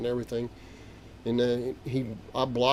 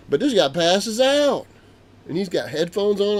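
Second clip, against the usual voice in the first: alarmed retelling; 7 semitones higher, 5 dB louder than usual. Retold or imitated. Grandpa telling the alarming scene.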